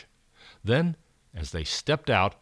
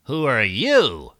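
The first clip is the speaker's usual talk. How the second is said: louder, slow alien question; slow creature voice; 7 dB louder, slower than usual. Acted. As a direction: drag it out like an odd creature. Creature answers back.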